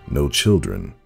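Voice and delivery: ordinary delivery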